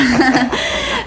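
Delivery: short bright burst of laughter; real laugh. Real, not acted. Wife laughing about her happy envy, real laughter. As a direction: laugh at her own joke.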